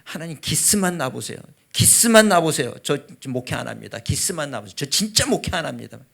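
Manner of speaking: loud, high-pitched retold ultimatum; threatening prayer. Father threatening God to quit the ministry, heated. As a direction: hammer out each threat to God, bursting upward. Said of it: "하나님을 협박하는 기도".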